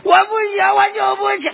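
high shouted fake crying; hammed-up sobbing refusal. Fake mourner refusing to get up, staged sobbing. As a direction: overplay the inconsolable mourner.

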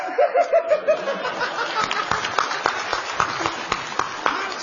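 long high burst of laughter. Real, not acted. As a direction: laugh out after the song. Laughing after the song.

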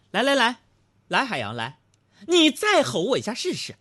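imitated threatening wife, mostly high; glaring wife's challenge. Wife glaring at husband who yelled, mock-threatening challenge. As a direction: dare him in a mock-menacing wife's voice. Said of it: "杨嫂瞪着我说".

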